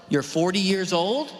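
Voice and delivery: normal talking voice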